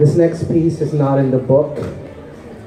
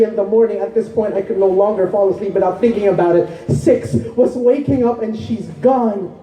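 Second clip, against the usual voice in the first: higher-pitched, urgent poetry recital; impassioned spoken-word poem; 10 semitones higher than usual. Acted. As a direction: pour out the heartbreak as slam poetry. Poem turns to personal heartbreak.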